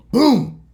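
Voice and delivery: loud, high "boom"; imitated door slam. Storyteller mimicking the counselor's door slamming, explosive boom.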